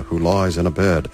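usual speaking voice

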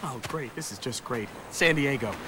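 gloomy mutter. Acted. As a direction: mutter it to yourself in despair. Despairing mutter.

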